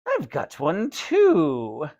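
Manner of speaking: slow, eerie put-on dummy voice; dummy screech. A narrator's reading. Dummy taunting the boy, slow creepy screech. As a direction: drag it out like a creepy puppet. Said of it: "the dummy screeched".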